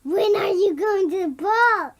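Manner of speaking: loud, mock-grand mother's voice; child copies mom with grand loudness; mommy voice. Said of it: "This is my mommy voice".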